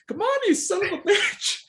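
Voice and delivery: put-on high old man voice, laughing through it; straining old man yelling.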